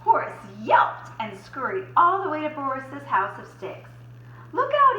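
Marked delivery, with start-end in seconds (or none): 4.5-5.0 high, loud squealing warning; panicked cry. Acted. Horace warning Boris as the wolf comes. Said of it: "he cried".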